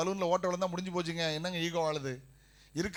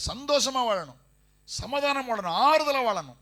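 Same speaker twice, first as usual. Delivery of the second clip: fervent preaching; 6 semitones higher, 5 dB louder than usual. Real, not acted. Preaching blessing climax.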